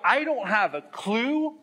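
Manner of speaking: high, slow clueless shrug voice; mock clueless. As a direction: play it helpless and unsure. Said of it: "And if you go".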